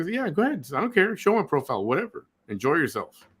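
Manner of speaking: high, carefree and dismissive; breezy don't care. Shown as a demonstration. Advising followers how to answer her threat, high and breezy. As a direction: brush her off like it's nothing. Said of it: "and you go".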